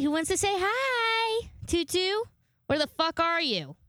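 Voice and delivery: high sing-song pet call; calling the dog. Real, not acted. Calling her dog over.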